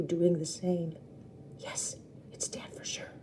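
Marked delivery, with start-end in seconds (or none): 1.6-3.1 hushed whisper; hiding child whispering about Dad. Acted. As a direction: whisper so the men won't hear.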